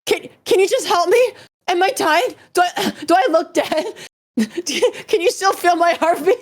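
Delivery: mock panic, laughing. Friend begging for help after espresso, hammed-up panic. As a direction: ham up the dying friend, giggling. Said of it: "he was like".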